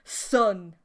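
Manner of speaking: loud angry bark of Son; spat. Acted. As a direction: spit the word out in disgust. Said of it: "Dad spat".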